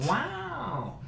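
high, soft drawn-out wow; playful falsetto wow; mock amazement. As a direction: coo it high in exaggerated wonder. Exaggerated reaction to the performance.

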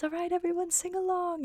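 lightly voiced gentle old English narrator inviting the crowd; Mrs Potts voice. Narrator inviting the audience, lightly voiced kindly Mrs Potts. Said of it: "Missus Potts just says".

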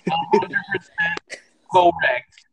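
slow, high, stretched emphasis; drawn-out emphasis. Acted. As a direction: stretch out hundred percent.

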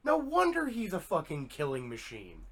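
lightly hammed-up disbelief; mock exasperated rant. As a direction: list it like it's ridiculous. Voicing Jason's realization.

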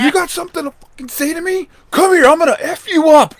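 high, loud angry challenge; reenacted fury; retold threat. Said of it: "and I said".

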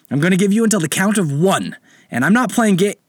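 loud, high menacing captain; threatening pirate captain. Acted.

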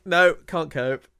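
loud, drawn-out cry of overwhelm; explosive outburst. Retold or imitated. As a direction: imitate a sudden panicked blow-up, slow and loud. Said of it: "good at those explosive moments".

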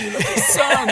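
high, breathy and half-laughing; dying man, laughing. Acted. Dying Outback mocks tenderness, laughing. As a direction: laugh through a fake tender line. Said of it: "He goes".

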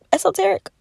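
high, doubtful rising question; unsure question. Real, not acted. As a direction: check the word, unsure. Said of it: "is that the word".

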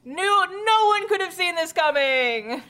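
high, theatrical sarcastic denial; mock astonishment. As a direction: proclaim it in fake shock. Sarcasm about predictable twist.

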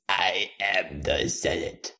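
slow, hushed movie-character voice; Star Wars impression. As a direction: draw it out like a dramatic film villain. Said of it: "sick of your impressions".

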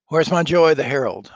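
loud, high royal call; commanding shout. Acted. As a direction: summon the herald. King calls for herald.

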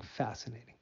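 softly said, trailing off; soft murmur. Acted. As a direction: let the word fade out quietly. Repeats word, then whispers it.

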